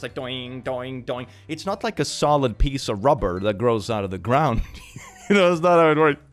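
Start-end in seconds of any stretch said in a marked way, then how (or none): 5.3-6.2 put-on voice with dismissive chuckles; sarcastic skeptic, chuckling between jabs; scoffing know-it-all. Doubter dismissing a rubber tree video, smug scoffing.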